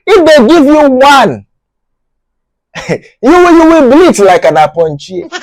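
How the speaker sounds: high laughing threat. Real, not acted. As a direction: gloat through laughter.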